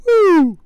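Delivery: high loud siren whoop; mock siren wail; siren woo. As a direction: imitate a police siren rising. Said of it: "siren as cops arrive".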